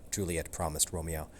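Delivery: plain reading voice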